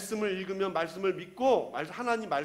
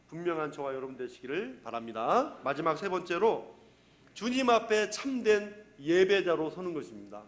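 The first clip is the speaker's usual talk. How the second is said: ordinary talk, nothing marked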